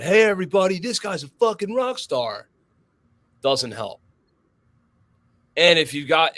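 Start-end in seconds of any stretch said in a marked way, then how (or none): 0.0-2.5 imitating someone hyping the shooter; hyped-up announcement.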